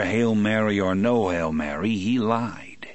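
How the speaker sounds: plain reading voice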